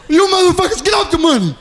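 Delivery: high-pitched stick-up holler; mock tough threat. Young performer bursts in with a blank pistol, mock tough holler. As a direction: holler it to scare the whole office.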